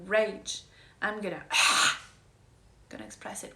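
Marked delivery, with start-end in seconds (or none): 1.5-2.1 loud audible exhale; heavy sigh. Shown as a demonstration. Showing how to release rage, a loud sigh. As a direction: let out a big sigh.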